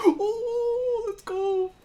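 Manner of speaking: high-pitched excited yelp; excited cheer. Real, not acted. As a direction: cheer the shot, voice jumping up. Excited cheer at anime shot.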